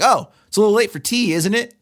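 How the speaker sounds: a hint of a sneering husband, quick; mock condescending. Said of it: "And he's like".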